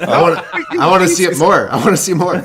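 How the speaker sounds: laughing tease. Real, not acted. Egging the cohost to repeat the gesture, laughing loudly. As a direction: egg him on between laughs. Teasing, laughing, louder and higher.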